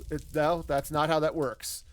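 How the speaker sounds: ordinary talk, nothing marked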